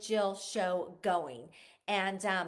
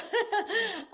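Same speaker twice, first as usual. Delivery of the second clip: bright burst of laughter, higher and louder; joyful laugh; 10 semitones higher, 7 dB louder than usual. Real, not acted. Mother laughing over her son's upcoming wedding, real laugh. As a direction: let the happy laugh spill out.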